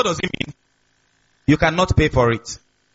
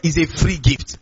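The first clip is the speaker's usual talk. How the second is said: deliberate weight on the word "free"; stress on free; 3 semitones lower than usual. Real, not acted. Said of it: "Emphasis on free".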